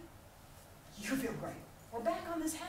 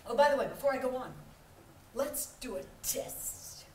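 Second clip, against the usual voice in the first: normal talking voice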